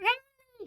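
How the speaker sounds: very high imitated chipmunk squeak; high-pitched cartoon chipmunk noise; chipmunk squeal. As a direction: squeal like a sped-up tape. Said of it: "like chipmunks".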